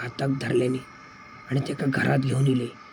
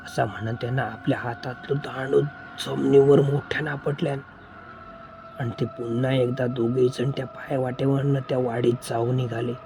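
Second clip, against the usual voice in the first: ordinary delivery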